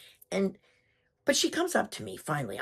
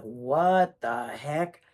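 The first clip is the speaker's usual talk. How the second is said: very slow, drawn-out astonishment; stunned disbelief; 4 dB louder, much slower than usual. Retold or imitated. Walking into an identical house, slow stunned disbelief. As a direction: stretch out every word, dumbfounded.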